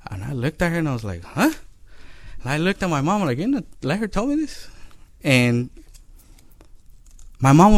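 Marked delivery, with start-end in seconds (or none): 1.2-1.5 surprised huh. Retold or imitated. Child reacting to rude lady. 3.4-4.7 put-on hurt child. Child asking mom in disbelief.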